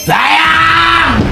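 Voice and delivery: excited shout. Acted. Excited call to viewers.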